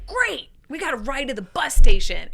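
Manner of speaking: high, loud burst of delight; thrilled, punchy retelling; excited. Retold or imitated. Retold joy at getting a ride.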